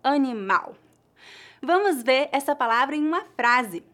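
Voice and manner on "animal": "animal" as a-ni-MAU, stress on the last syllable and the final L said like U; Portuguese pronunciation. Shown as a demonstration. Teacher models the Portuguese word for learners to repeat, clear Portuguese pronunciation. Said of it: "Faça de conta que a letra L no final é a letra W".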